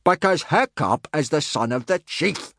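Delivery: high, emphatic bellowed answer; big high teacher's voice, drawn out at the end; bellowed retort. A narrator's reading. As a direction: bellow it back at the complainer, slowing down. Gobber answers the complaint, acted.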